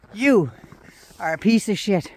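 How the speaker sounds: ordinary talk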